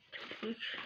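soft whisper. Real, not acted. Whispered aside.

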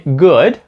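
"Good" starting with Ukrainian Г; learner's error, h sound opening "Good"; mispronounced GOOD. Shown as a demonstration. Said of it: "вони кажуть Good".

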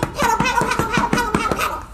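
high, rapid-fire repeated patter; paddling patter. Shown as a demonstration. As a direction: rattle it off like little splashing feet. Mimics the duck paddling for children.

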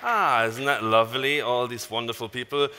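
usual speaking voice

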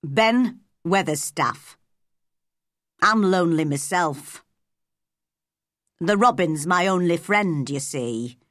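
put-on old Yorkshire gardener; Yorkshire dialect. A narrator's reading. Gardener introduces himself to Mary, in Yorkshire dialect. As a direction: answer in a Yorkshire moorman's accent. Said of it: "in strong Yorkshire dialect".

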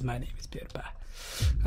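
hushed greeting; whispered intro. Acted. Host introducing himself while hiding, whispering.

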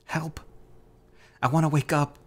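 high-pitched panicked yelp; frightened, pitched well up; desperate yelping. A narrator's reading. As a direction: cry out as if trapped in a nightmare. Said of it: "I continue to yelp".

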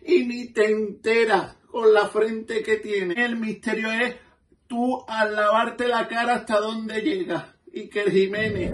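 put-on high, slow, laughing old duchess voice; Duquesa de Alba.

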